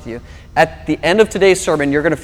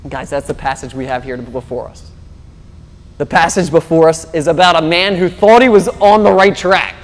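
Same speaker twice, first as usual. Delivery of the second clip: normal talking voice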